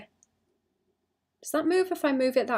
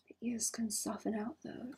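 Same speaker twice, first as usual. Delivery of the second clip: whisper; 15 dB quieter than usual. Real, not acted. Muttering to self while drawing.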